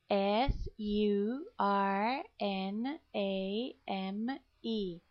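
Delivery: letters spelled out slowly one by one; slow spelling. Shown as a demonstration. Teacher spelling for students, slow and clear. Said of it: "I'm going to spell surname".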